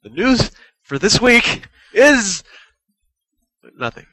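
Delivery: mock drama. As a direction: hype it like big news, then drop it. Mock-dramatic build to no news.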